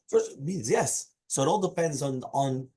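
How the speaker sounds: normal talking voice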